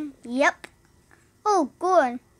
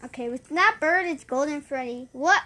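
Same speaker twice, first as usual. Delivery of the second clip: ordinary delivery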